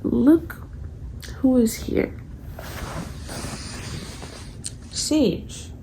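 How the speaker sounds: high, sweet pet talk; cooing to a pet. Real, not acted. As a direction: talk to the cat lovingly. Talking to her cat.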